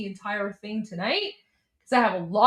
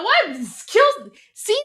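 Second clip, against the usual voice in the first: sharp high jump in pitch, louder; shocked disbelief; 9 semitones higher, 5 dB louder, slower than usual. Real, not acted. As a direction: hit it like you can't believe what you just heard. Disbelief at the congressman's remark.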